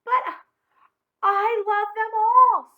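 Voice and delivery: high, loud, slow wailing plea; hammed-up childish wail; crying out. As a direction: wail it like a heartbroken kid. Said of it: "Spencer cried".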